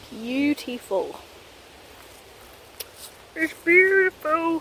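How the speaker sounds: slow, savoured praise of the scenery; hushed admiration, drawn out; awed. Real, not acted. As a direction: linger on beautiful in real wonder. Admiring the forest view.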